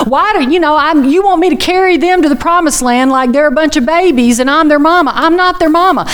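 high, complaining whine; whiny Moses. Retold or imitated. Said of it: "and he's like".

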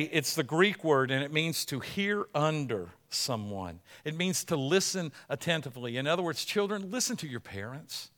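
usual speaking voice

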